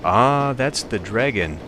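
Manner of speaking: loud, drawn-out moment of recognition; sudden realization. Real, not acted. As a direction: call out slowly as it dawns on you. Suddenly works out who it is.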